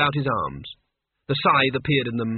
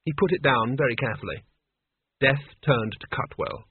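ordinary delivery

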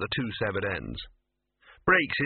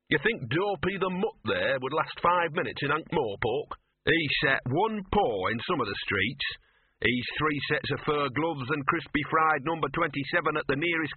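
higher-pitched, cocky street mutt; boastful dog; 4 semitones higher than usual. Acted. Streetwise dog scorning the pampered pup, cocky. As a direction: swagger as a tough little stray.

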